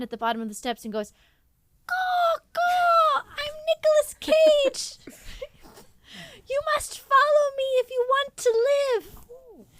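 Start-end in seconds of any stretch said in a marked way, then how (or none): 1.9-9.7 Nicolas Cage as a crow, squawked high and loud. Acted. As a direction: caw like a crow playing the hero. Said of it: "I can't do the, the high voice".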